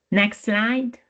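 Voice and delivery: ordinary talk, nothing marked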